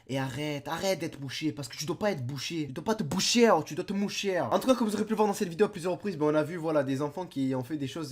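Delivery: ordinary talk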